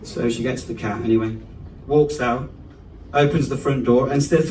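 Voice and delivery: ordinary delivery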